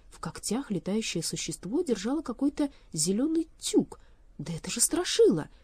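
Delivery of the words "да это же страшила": startled, voice rising on the name; startled recognition. A narrator's reading. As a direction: gasp out the name in alarm. Elli recognizes her friend.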